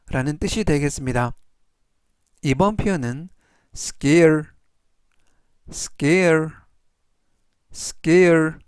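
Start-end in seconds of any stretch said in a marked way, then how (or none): 3.8-8.5 scare said clearly, three times; teacher modelling scare for learners to repeat; model pronunciation. Shown as a demonstration. Teacher drilling scare for the class, slow and clear. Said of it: "이라고 발음하시면 되겠고요".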